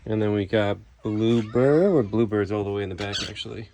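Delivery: usual speaking voice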